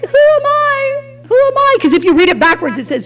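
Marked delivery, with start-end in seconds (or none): 0.1-1.8 much higher, searching who am I; high questioning. Shown as a demonstration. Preacher showing the hidden question, much higher. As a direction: lift it up like a lost question.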